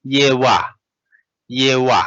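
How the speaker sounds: "Ye wa", w instead of p; ye wa with wa, not pa. Shown as a demonstration. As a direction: say "Ye wa" with a clear w sound, not p. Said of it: "remember our rule, this pa is no more pa".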